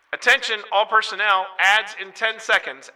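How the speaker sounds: hammed-up military PA warning; mock announcement. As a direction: call it over the base intercom. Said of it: "announcing ads".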